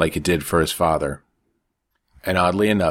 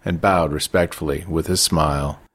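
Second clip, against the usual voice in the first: usual speaking voice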